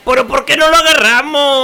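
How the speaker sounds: loud, high Mexican wail; Mexican accent. Pretend. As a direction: lament it in a put-on Mexican accent. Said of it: "[name], nadie habla así". (lamento con acento mexicano)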